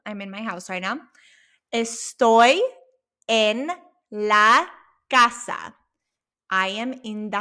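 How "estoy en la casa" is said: "Estoy en la casa" each word spoken separately and loud; word by word drill. Shown as a demonstration. Teacher drilling the class word by word. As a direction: space out "Estoy en la casa" one word at a time. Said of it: "practice with me".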